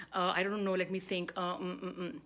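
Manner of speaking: hesitant run of fillers trailing into hums; nervous buffering. Shown as a demonstration. Speaker contrasts default buffers with silence, hesitant. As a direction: stall for time, filling every gap with noise. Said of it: "I'm just contrasting your defaults".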